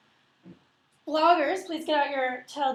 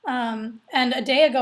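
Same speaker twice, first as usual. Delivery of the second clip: normal talking voice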